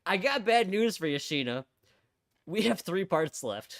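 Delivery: slow, loud smug gloat; loud gloating taunt. Acted. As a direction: lord it over her, slowly. Voicing character's taunt.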